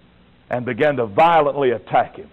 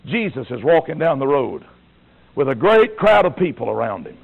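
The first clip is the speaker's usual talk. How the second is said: ordinary delivery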